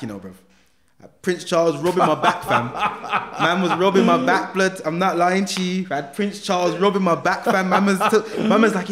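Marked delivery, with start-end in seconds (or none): 1.2-8.9 loud, higher-pitched amazed retelling; animated, raised-voice disbelief; incredulous excitement. Real, not acted. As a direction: tell it like nobody will believe you.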